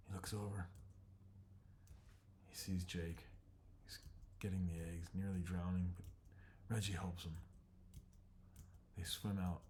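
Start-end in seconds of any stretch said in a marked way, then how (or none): none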